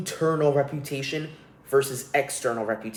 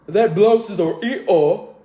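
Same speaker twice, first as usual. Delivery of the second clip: loud, higher, drawn-out British caricature; mock British accent; 5 semitones higher, 7 dB louder, slower than usual. Podcaster mocking British pronunciation, exaggerated British accent. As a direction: ham up a British accent with dropped R sounds. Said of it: "sound like toddlers who don't know how".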